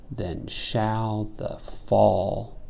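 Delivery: "then shall the fall" very slow, stretched word by word; pause at caesura. Shown as a demonstration.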